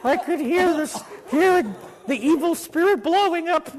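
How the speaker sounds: loud, high, hammed-up praying healer; praying grandfather. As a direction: imitate a healer crying out in fervent prayer. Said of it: "my grandfather was still praying".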